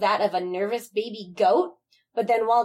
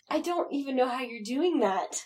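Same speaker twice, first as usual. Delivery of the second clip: ordinary delivery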